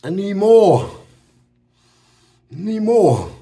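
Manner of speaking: loud, high, hammed-up roar of craving; hungry demand.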